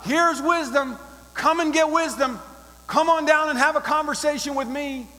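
loud, inviting crier's call. Acted. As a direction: call across the streets for everyone to hear. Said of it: "She's calling out".

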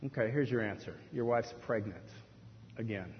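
low, quiet, matter-of-fact delivery; deadpan answer. Acted. God answers the preacher's prayer, deadpan. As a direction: break the news softly and evenly.

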